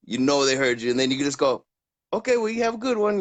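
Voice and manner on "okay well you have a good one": higher, mock-cheery sign-off; snubbed clerk. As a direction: brush off the snub with fake cheer. Mimicking a snubbed clerk's farewell.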